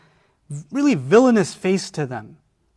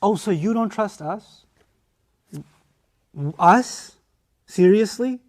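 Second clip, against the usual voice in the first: offended; 3 semitones higher, slower than usual. Acted. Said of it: "that's the next tactic".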